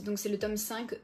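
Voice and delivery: ordinary talk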